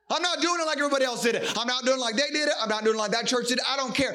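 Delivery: fired-up sermon delivery; preaching. Acted. Pastor preaching to the church, fired up. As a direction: preach it hard to the congregation.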